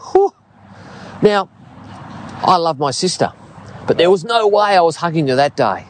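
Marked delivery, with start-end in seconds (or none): none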